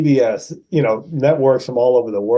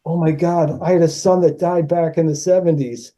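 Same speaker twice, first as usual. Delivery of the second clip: higher-pitched imitated astonishment; mild mock shock; retold amazement; 5 semitones higher than usual. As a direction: act out her wide-eyed reaction. Co-star reacts, eyes get wide.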